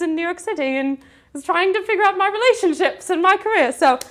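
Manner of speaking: put-on playful British accent, performed on the spot. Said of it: "in a British accent".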